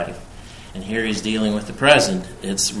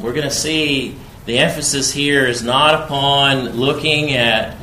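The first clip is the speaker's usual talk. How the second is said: usual speaking voice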